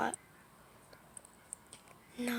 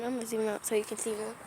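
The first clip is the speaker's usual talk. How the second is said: usual speaking voice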